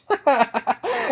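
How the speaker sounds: high-pitched burst of laughter; high, rolling story-character laugh; acted laughing. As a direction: crack up at yourself, high and in character. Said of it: "he just starts laughing".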